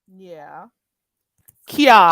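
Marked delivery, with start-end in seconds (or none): 1.7-2.1 kiad said loud with a Y glide, no R; patois card. Shown as a demonstration. Teacher drilling the patois word card. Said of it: "No R".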